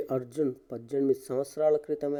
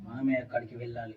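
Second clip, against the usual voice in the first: ordinary delivery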